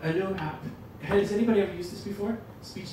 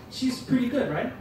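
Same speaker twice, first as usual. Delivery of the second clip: ordinary talk, nothing marked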